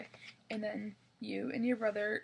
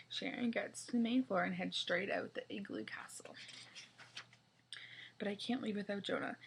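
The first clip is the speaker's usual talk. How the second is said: plain reading voice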